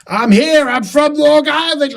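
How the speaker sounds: very high, loud Long Island caricature; Long Island accent. Acted. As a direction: announce yourself like an obnoxious Long Islander. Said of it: "that is the quintessential fucking Long Island person".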